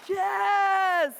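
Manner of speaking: loud, high cheer over the applause; excited shout. Real, not acted. Speaker cheering as the audience applauds, excited shout. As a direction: call out over the clapping crowd.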